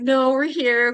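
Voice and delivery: retold firm objection; firm refusal. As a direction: push back against the panic. Arguing back to panicking Scott.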